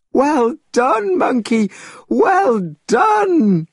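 high, loud cheering shout; shouting students. A narrator's reading. Students cheering Monkey's tree trick, high loud shout. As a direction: cheer him on at full voice. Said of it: "shouted the other students".

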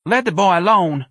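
high, loud heckle; voice from the crowd. A narrator's reading. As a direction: call out in protest from the crowd. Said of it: "a voice said from the crowd".

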